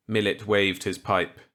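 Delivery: ordinary delivery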